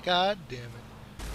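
normal talking voice

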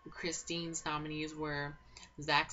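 ordinary talk, nothing marked

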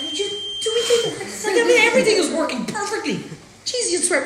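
high, giggling words through laughter; laughing. Real, not acted. Cook laughing to the crew about needing no editors. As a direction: laugh as you brag. Laughing at own perfect cooking.

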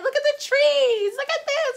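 very high, loud mock excitement; exaggerated high sister impression; excited sister. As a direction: squeal it like an eager sightseer. Sister pointing things out excitedly.

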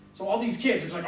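usual speaking voice

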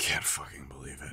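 whispered disbelief; exasperated whisper. Acted. Frustrated partner whispering over her sleeping girl. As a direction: breathe it out in annoyance.

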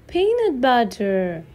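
usual speaking voice

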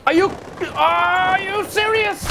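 high, slow, stretched-out disbelief; real exasperated are you serious; incredulous outburst. Real, not acted. Frustrated skater at his failed trick, high drawn-out disbelief. Fails the landing again.

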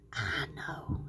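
hushed, low little idea; secretive whisper. Acted. Tweety getting an idea to himself, secretive whisper. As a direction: whisper it to yourself.

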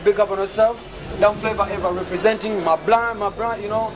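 upbeat shout-out promo, hyping the crowd; hype promo. Pretend. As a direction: pump up the party invite. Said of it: "just do a, a simple promo for us right here on the red carpet".

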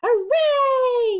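high, loud cheering yell; crowd yell. Acted. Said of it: "yelled the crowd".

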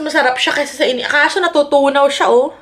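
chewing while talking; muffled by food; mouth full of cake. Real, not acted. Seen 3: Bite at -1.0; pursed, working lips while holding chocolate cake.

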